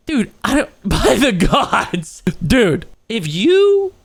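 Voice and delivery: loud, slow exasperated outburst; outraged. Real, not acted. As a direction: drag it out in disbelief. Outraged exclamation.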